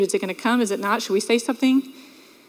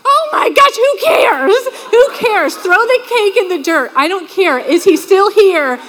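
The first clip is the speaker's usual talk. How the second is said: high, loud frantic bride's relief; half-shouting, much higher, in a rush of relief; a retold bride shouting with relief that the groom stayed; 7 semitones higher, 5 dB louder, slower than usual. Retelling panicked bride at wedding.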